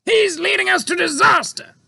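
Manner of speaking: high, loud, panicked cry; cried in fear. A narrator's reading. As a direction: Air Force chief crying out, shaking with fear. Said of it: "cried ... shaking with fear".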